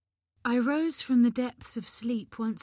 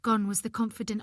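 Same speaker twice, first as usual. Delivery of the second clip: usual speaking voice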